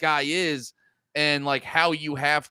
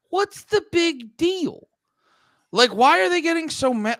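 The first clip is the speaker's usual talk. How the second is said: mock puzzled; 10 semitones higher than usual. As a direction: play your own confused reaction up high. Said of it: "'cause I was watching it being like".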